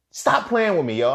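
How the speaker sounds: high, loud retold protest; incredulous protest. Acted disbelief at her quitting.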